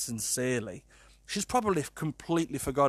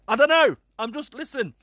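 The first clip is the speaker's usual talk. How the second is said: high, loud exasperated cry; exasperated outburst; 10 semitones higher, 8 dB louder than usual. Real, not acted. Host baffled by the product logic, loud and high. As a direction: throw up your hands.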